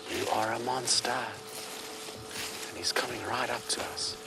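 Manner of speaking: soft, slow hushed voice. Real, not acted. Guide hushing viewers as elephant comes close, slow and quiet.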